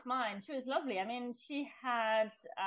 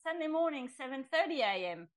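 surprised acceptance; 7 semitones higher than usual. Retold or imitated. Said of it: "to my surprise, I hear myself say".

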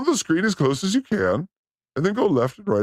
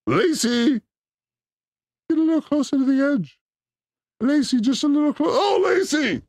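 higher, coaxing voice that breaks into a shout at the end; mock Scott Peterson; 9 semitones higher than usual. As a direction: lure her closer, then yell her name. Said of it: "Scott Peterson".

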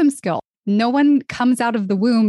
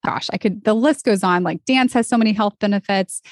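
usual speaking voice

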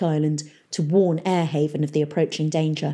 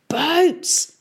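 shouted; 10 semitones higher than usual. Acted. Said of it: "shouted Mungo".

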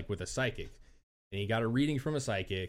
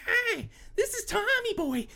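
much higher put-on boy voice; mock child spirit. Medium voicing spirit Tommy Boy to the seance crowd, much higher. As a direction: squeak it out like a fake little boy. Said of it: "it doesn't sound like a boy at all".

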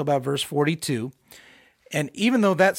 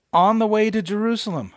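louder, higher heading read-out; mock announcement; 6 semitones higher, 5 dB louder than usual. Shown as a demonstration. Preacher reading out the app's subheading, in mock announcement. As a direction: announce it like a grand title.

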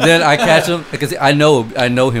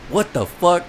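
higher-pitched quoted disbelief; mock baffled what-the-fuck; shocked reaction; 7 semitones higher, slower than usual. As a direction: imitate their shocked question. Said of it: "when they were like".

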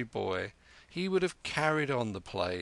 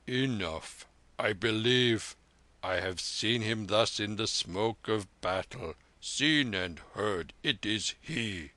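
put-on an old veteran pressing for the detail; old soldier.

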